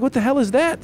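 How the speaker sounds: put-on a dad shocked his kid threw the ball away; incredulous outburst. Dad retells reaction to son.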